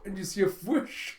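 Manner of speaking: ordinary talk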